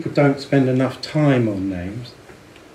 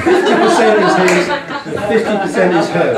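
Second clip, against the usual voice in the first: high, laughing delivery of a joke name; amused, half-laughing; 7 semitones higher, 7 dB louder than usual. Real, not acted. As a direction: giggle through the punchline. Reading joke bar name, laughing.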